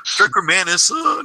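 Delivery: high mock fanboy cheer; hammed-up excitement, pitched well up; imitating gushing fans. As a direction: crow it like a thrilled fan. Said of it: "some people like".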